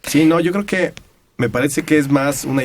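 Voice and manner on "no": normal talking voice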